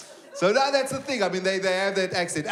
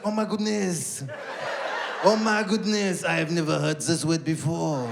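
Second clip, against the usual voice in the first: put-on French woman's accent; 2 semitones lower than usual. French woman praises the son's accent, admiring French accent. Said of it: "the French woman comes up".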